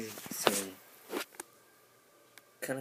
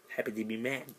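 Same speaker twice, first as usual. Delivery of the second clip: mock mad; 5 dB louder than usual. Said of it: "Did I mention my moods?".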